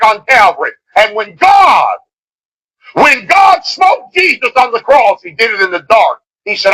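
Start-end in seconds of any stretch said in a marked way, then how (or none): 1.0-5.2 slow, high, shouted preaching. Acted. Preacher shouting slowly to the congregation about God and Jesus. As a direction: call it out slowly, like a revival preacher at his peak.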